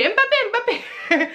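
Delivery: put-on high, laughing child's voice calling Mbappé; cute high little-girl impression through laughter; imitating a little girl. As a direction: mimic a little girl excitedly saying Mbappé. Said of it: "La petite, elle fait".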